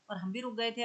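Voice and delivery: ordinary talk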